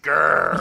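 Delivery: "Grr" growled with a long R; loud, high, dog-like "Grr"; rolled-out multiple R's. Shown as a demonstration. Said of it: "with multiple R's".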